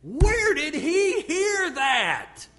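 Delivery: loud, high, slow call to the next room. Retold or imitated. Father calling to wife Tammy, loud slow shocked call. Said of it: "I called out to Tammy".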